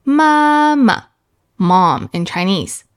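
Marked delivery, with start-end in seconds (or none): 0.0-1.1 mama high and long, then short and light; "mama" with a sustained high start and light ending; first then neutral tone. Shown as a demonstration. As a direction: hold the first "ma" high, tap the second. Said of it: "Put them together".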